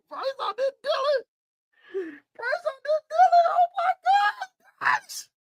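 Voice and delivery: very high squealing, laughing yells; shrieking disbelief. Real, not acted. Fan watching a stunning touchdown, very high shrieking. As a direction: scream in amazed delight. Shocked at Carolina's winning pass.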